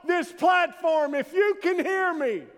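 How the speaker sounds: very high, loud yelled challenge; professor hollering. Acted. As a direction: bellow the dare so the hallway hears. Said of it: "heard the professor holler".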